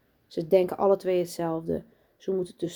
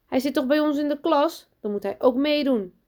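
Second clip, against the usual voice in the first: high, louder complaint; indignant; 8 semitones higher, 5 dB louder than usual. A narrator's reading. Daan protests Lucas skipping the weeding, indignant. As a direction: argue that the rule applies to everyone. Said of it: "kijkt verontwaardigd op".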